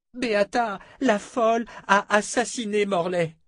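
high, urgent alarm; desperate pleading. A narrator's reading. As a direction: plead to be believed. Fitch reports a murder, pleading.

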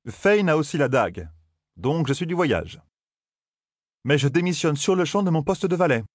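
lightly voiced cheeky youth; young Matt voice. A narrator's reading. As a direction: toss it off with brash bravado.

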